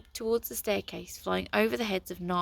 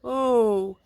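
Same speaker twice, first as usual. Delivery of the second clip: a sulky letdown oh; disappointed sulk; 4 semitones higher, 11 dB louder than usual. A narrator's reading. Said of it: "sulked the other books in disappointment".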